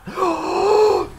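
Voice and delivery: sharp, high gasp of disbelief; sudden gasp as the star player appears; excited gasp. Real, not acted. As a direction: gasp at the card reveal. Pulls Salah from pack.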